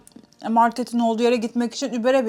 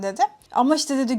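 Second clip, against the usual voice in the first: normal talking voice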